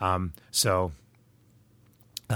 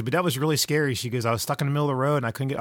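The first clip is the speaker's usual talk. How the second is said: higher and louder, rushing the last line; shaken daughter; 5 semitones higher, 4 dB louder, faster than usual. Retold or imitated. Daughter stuck on the road, rattled and higher. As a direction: retell her scare in a rattled voice. She just started crying.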